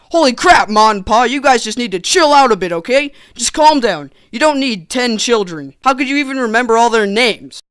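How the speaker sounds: loud, incredulous telling-off; hammed-up bewildered protest; mock exasperation. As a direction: plead with them in comic disbelief. Scolds Ma and Pa in disbelief.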